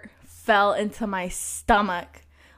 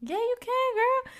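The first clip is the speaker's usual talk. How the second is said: teasing. Real, not acted. Teasing coax.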